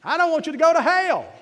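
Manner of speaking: high, loud mountain preacher's cry on hell; preaching shout. Retold or imitated. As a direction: plead it at full voice like a country preacher. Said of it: "And that's how he said it".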